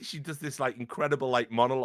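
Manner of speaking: ordinary talk, nothing marked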